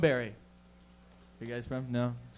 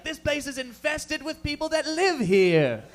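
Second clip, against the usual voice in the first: mock indignant colonizer; 11 semitones higher, 5 dB louder, slower than usual. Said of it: "be like".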